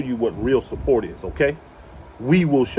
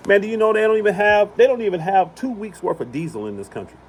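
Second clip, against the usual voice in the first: normal talking voice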